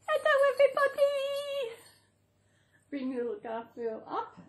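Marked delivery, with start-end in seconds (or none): 0.1-1.7 high cutesy voice. Pretend. Greets viewers as pet.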